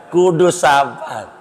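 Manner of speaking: ordinary talk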